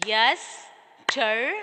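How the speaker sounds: "Yesterday" as yes... ter... day; slow, separate beats on "Yesterday"; syllable by syllable. Shown as a demonstration. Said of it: "this how you can understand the syllables".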